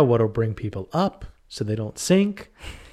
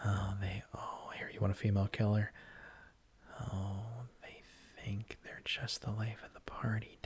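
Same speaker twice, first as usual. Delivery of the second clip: creepy killer whisper; 12 dB quieter than usual. Acted. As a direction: whisper like an unhinged slasher. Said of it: "the voice of the killer".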